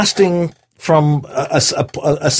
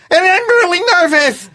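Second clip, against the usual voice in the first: nervous Joe Pesci; 13 semitones higher than usual. Pretend. Actor plays Joe Pesci with braces, very nervous. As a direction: panic like he'll get hit. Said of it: "got my Joe Pesci with braces, uh, and he's really nervous".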